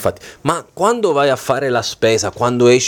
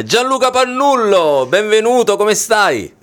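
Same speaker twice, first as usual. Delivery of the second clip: host welcome; 8 semitones higher than usual. Real, not acted. Host welcomes guest on air.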